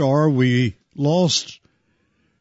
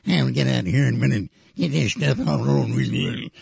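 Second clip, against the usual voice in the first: put-on low, mumbled old movie actor voice; quick, low old-timer's voice; Edgar Buchanan impression; 4 semitones lower, 5 dB quieter, faster than usual. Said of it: "He talked like this".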